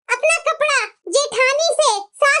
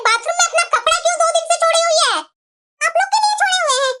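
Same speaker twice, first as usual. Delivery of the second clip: ordinary talk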